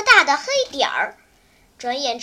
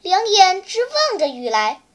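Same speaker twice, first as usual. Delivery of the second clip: ordinary delivery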